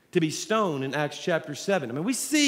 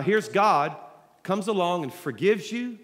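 ordinary talk, nothing marked

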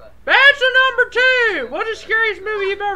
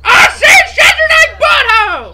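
louder half-laughing shout; exasperated yell; 5 semitones higher, 9 dB louder than usual. Real, not acted. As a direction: shout them down, fed up. Sibling yelling at S0.

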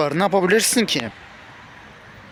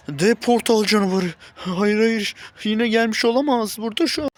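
panicked cry; 6 semitones higher, 4 dB louder than usual. Acted. Monster appears, panics.

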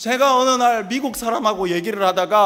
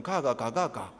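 clipped Busan "ga"; 9 semitones lower, 9 dB quieter, slower than usual. Retold or imitated. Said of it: "이렇게 얘기하잖아요".

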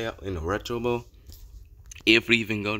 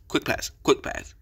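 normal talking voice

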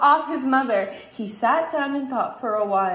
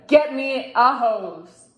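father's order; 4 dB louder, slower than usual. A narrator's reading. Father orders his son.

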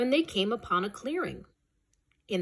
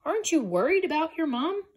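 anxious question; 8 semitones higher, faster than usual. Acted. Calf asks anxiously.

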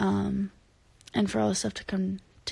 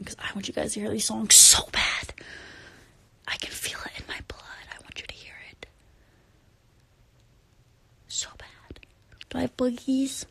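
breathless secret whisper, barely there; 5 dB quieter than usual. Real, not acted. Whispering her promise to fans on a late livestream. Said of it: "I don't know why I'm whispering".

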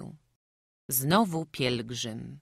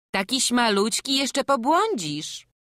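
worried farmwife; 9 semitones higher, 7 dB louder than usual. Acted. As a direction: fuss over the little boy. Farmwife worries over boy.

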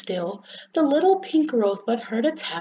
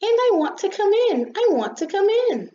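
high, louder, eager little sun; cheery little voice; 11 semitones higher, 5 dB louder than usual. Acted. Sun begs the rosebud to let it in, cheerily. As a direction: plead cheerily, high and insistent. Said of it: "said a cheery little voice".

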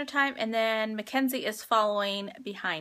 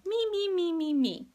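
higher, whiny imitation of a greedy person; mock the selfish "me, me, me" crowd; playful greedy chant; mock greedy whine; 7 semitones higher than usual. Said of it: "instead of being going".

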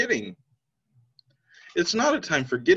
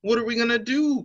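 crowed; 6 semitones higher than usual. Acted. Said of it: "crowed the rooster".

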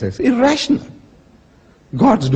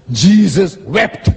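slow emphatic recitation; 2 semitones higher, much slower than usual. Shown as a demonstration. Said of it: "The shortest sentence in the Bible".

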